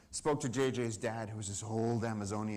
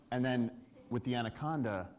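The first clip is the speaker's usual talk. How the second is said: usual speaking voice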